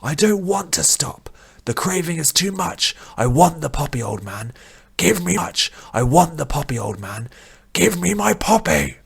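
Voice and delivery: high, strained begging cry; an addict pinned down, crying out high and loud for his poppy; desperate shout. Acted. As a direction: plead loudly for the drug, voice pitched high. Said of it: "Vish shouted back".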